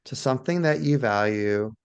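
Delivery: guided meditation. Shown as a demonstration. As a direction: gently guide listeners into awe, phrase by phrase. Leads a guided awe meditation.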